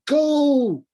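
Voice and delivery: high, loud desperate cry; sudden high loud plea; pleading cry. A narrator's reading. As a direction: cry out to save him.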